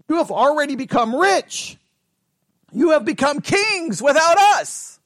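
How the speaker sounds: high, ringing mock praise; sarcastic. Teacher reading Paul's taunt to the Corinthians, sarcastic. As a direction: proclaim it with loaded irony. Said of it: "that's sarcasm".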